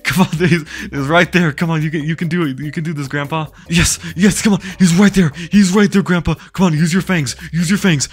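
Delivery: high, loud urging cheer; excited cheering. Real, not acted. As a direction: egg him on, voice climbing high. Cheering grandpa evoker to attack.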